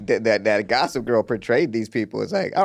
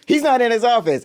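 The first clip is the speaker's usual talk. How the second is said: much higher-pitched put-on voice; mock secretary; 11 semitones higher, 4 dB louder than usual. Secretary turning visitors away, high mock voice. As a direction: play the gatekeeper in a raised pitch. Playing secretary in movie scene.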